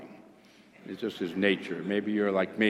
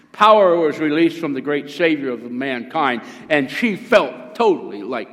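loud, emphatic preacher's proclamation; full-force preaching; 3 semitones higher, 8 dB louder than usual. Acted. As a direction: proclaim it powerfully to the congregation.

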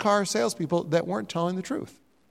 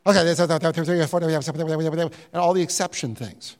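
rapid-fire garbled small print; radio disclaimer gabble; 3 semitones lower than usual. Acted. Preacher imitating the end of a radio advertisement, garbled gabble. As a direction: race through it as unintelligible legal patter. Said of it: "and then at the very end".

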